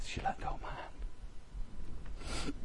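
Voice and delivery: hushed unvoiced heartbroken whisper. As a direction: barely breathe the words. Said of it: "and she just said".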